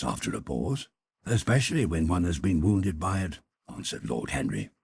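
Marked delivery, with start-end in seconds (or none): 1.2-3.4 a hint of a suave wit, only slightly raised. Lord Henry topping the Duchess's line, lightly voiced.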